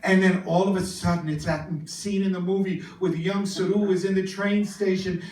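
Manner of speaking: usual speaking voice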